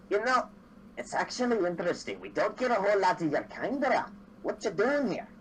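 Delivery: a hint of a nosy barkeep sizing up a stranger; curious dwarf bartender. Dwarf bartender leaning over to ask the newcomer, lightly voiced and curious. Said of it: "it kinda leans over and says".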